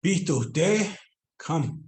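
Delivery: usual speaking voice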